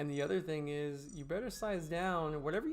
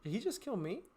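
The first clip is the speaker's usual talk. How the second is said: surprised; 6 semitones higher than usual. Real, not acted. Killed suddenly in the game.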